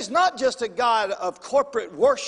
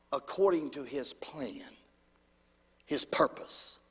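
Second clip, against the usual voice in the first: hushed, breathy-soft whisper on the point; 6 semitones lower, 8 dB quieter than usual. Real, not acted. Pastor whispering the point to his congregation, hushed. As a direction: lean in and whisper the key words. Sermon drops to a hush.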